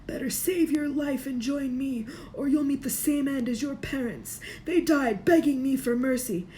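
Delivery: high, cold hissing threat; evil face coaxing Harry to join him; Voldemort's menace. A narrator's reading. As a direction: threaten the boy with icy menace.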